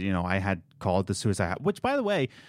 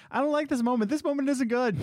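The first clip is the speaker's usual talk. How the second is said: a far higher, squeakier take on the quote; high whiny quote; 13 semitones higher than usual. Retold or imitated. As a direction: push the whine way up like the original clip. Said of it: "that was from something where they're just like".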